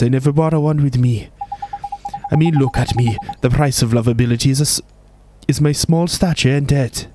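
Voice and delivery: lightly voiced Welsh fairy guide; cheeky Welsh lilt, setting the task; a hint of a Welsh accent.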